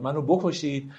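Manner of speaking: usual speaking voice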